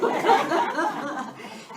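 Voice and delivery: loud, high peal of laughter; hearty laugh. Real, not acted. Co-host amused by her own answer, hearty laugh. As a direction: keep laughing, really tickled.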